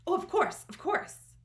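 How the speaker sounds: high, bright eager yes; eager reply. Acted. Eager character reply to James.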